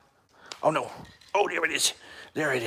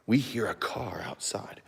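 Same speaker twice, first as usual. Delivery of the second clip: hushed dog voice, unvoiced; whisper; 6 semitones lower, 13 dB quieter than usual. Owner voicing dogs hearing a car, whispered. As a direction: whisper it like a secret. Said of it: "They're like".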